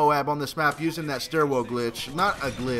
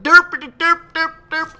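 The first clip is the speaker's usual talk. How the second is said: mock derp; 11 semitones higher, 9 dB louder, slower than usual. Mocking himself as dumb.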